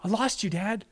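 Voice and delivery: put-on high, teary little-boy voice; a five-year-old who thought his dad was gone; crying little boy. Son telling dad he thought he lost him, in a high, teary child's voice.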